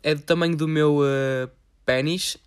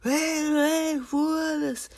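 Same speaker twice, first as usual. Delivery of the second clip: much higher, put-on schoolboy voice; mock a grumbling classmate, pitched up; rude classmate voice; 13 semitones higher, much slower than usual. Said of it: "a aula é toda".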